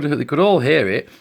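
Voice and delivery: ordinary delivery, nothing marked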